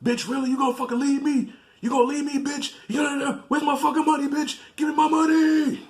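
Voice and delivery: high-pitched mock ranting; irate boyfriend. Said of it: "So he would call her".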